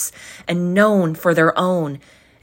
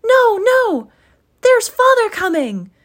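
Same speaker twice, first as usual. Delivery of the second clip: put-on very high, loud excited kids; little ones warning in a rush; high, loud children's alarm; children crying out; 8 dB louder than usual. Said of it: "cried the two young Cratchits".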